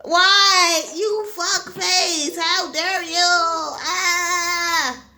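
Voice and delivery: loud, high anguished protest; mock outraged cry. Fan outraged at the cartoon, hammed-up anguished cry.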